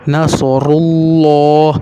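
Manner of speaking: نصر اللہ slow, with a thick rounded "llo"; heavy l. Shown as a demonstration. Teacher showing pesh before Allah, heavy l in نصر اللہ. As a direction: darken the l of اللہ after "ru" in نصر اللہ. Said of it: "تب آپ نے موٹا کرنا ہے".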